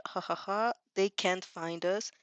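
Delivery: normal talking voice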